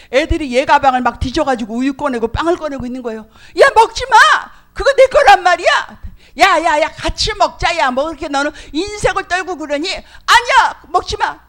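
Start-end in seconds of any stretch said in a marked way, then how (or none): 3.6-6.0 put-on high, loud desperate child yelling; a little girl screaming at classmates to stop; child's shout. Child defends her bread. 6.4-9.9 high, loud mocking child; a classmate teasing her, high and loud; taunting kid. 9.9-11.4 put-on high, loud desperate child yelling; a little girl screaming at classmates to stop; child's shout. Child refuses again.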